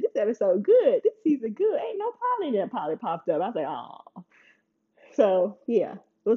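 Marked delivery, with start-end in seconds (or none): 0.0-2.5 high-pitched retold delight; happy relief. Vlogger recalls cheering a season without Polly, happy. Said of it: "I was so happy". 3.7-4.2 low, soft retold letdown; disappointed. Vlogger recalls Polly popping up, mock disappointed.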